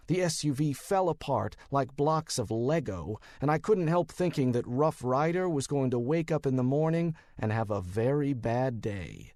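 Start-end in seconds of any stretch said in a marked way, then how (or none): none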